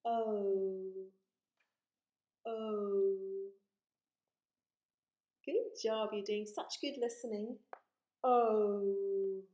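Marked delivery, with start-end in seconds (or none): 0.0-3.2 O held long and loud; long vowel O. Shown as a demonstration. Teacher shows the circle shape, O stretched loudly. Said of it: "That's how we write the O sound". 8.2-9.4 O held long and loud; long vowel O. Shown as a demonstration. Teacher shows the circle shape, O stretched loudly. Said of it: "That's how we write the O sound".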